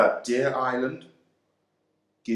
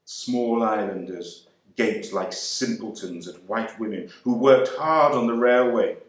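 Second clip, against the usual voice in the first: ordinary delivery, nothing marked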